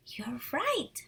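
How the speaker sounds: plain reading voice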